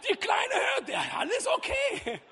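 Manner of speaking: high, breezy brush-off; lightly mimicking the doctor, high and breezy; mock reassurance. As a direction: parody the careless doctor lightly. Mocking the doctor's diagnosis.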